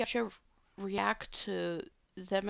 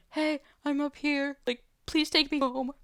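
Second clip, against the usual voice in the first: calling down; 8 semitones higher, 5 dB louder than usual. Retold or imitated. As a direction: call down from the tree, pleading. Calling down from a tree.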